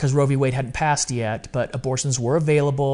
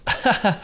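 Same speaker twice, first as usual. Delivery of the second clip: short laugh; 7 semitones higher than usual. Real, not acted. Laughs recalling email.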